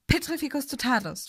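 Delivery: high-pitched cry, contained in volume; crying out a spell. A narrator's reading. Hermine casting a spell at Neville, crying out. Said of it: "schrie sie".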